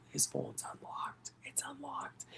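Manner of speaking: hushed whisper, slow, conspiratorial. Retold or imitated. Telling a friend the phone is unlocked, whispered. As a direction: breathe it like a secret. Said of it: "I'm like".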